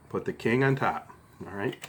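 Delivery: ordinary talk, nothing marked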